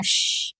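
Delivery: soft, voiceless "shh"; breath-only shh; demonstrated shush. Shown as a demonstration. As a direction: model a long hushing sound. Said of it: "इसका pronunciation क्या है".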